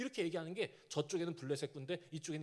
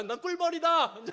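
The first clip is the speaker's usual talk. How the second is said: slow, higher, much louder, with 꿀벌 now said right; corrected loud declaration; 9 semitones higher, 11 dB louder than usual. Retold or imitated. Deborah correcting herself to Sisera, loud slow proclamation. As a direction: proclaim 꿀벌 big and slow, the word fixed. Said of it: "아, 벌꿀?".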